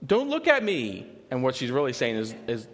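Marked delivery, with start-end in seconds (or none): 0.1-1.1 put-on high, slow, louder little-girl demand; toddler command. Daughter telling her parents not to watch, high slow child voice. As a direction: boss them like a small child. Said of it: "she'll say to us".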